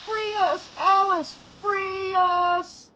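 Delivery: very high, soft insect-like plea; tiny pleading voice. Acted. Rick's tiny head pleading with Alice, tiny and soft. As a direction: beg small and desperate.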